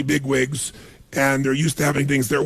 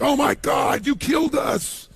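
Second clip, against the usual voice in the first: mock panicked yell; 8 semitones higher, slower than usual. Stranded tourists blaming the guide, hammed-up yell. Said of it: "the people are like".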